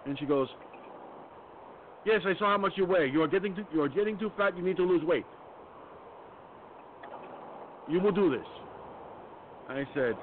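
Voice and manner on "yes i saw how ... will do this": pitched-up, lecturing mock doctor voice; imitating the foreign doctor. Patient mocks his doctor's scolding in a high imitation voice. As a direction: mimic her scolding him about his weight, voice up high. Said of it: "Oriental lady".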